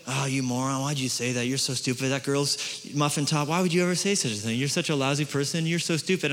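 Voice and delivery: low, soft self-scolding; negative self-talk. Acted. Preacher voicing his inner critic, quiet self-berating. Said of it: "my self-talk is negative".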